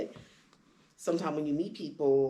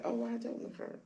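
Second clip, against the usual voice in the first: higher and softer, deflated; sheepish realisation; 8 semitones higher, 6 dB quieter than usual. Retold or imitated. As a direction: concede it quietly. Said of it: "you're like".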